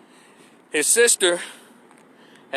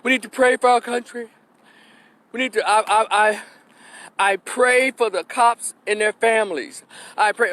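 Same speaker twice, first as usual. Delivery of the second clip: higher-pitched imitation of a mother's plea; mock praying mama; 6 semitones higher than usual. Mother appealing to the country for prayers, mocking imitation. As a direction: put on a pleading mama, voice raised in pitch. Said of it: "Here comes the mama".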